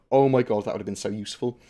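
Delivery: ordinary talk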